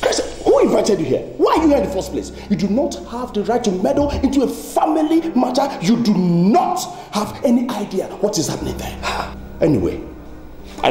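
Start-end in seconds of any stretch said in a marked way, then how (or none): 0.0-9.0 high-pitched angry scolding; heated, emphatic demand; indignant rebuke. Acted. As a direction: tell off the meddler, voice pitched up. Berates relatives for meddling.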